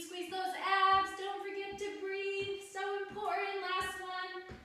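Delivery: high, sing-song encouragement; aerobics instructor. Real, not acted. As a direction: cheer them through the last rep. Coach cheering through final reps.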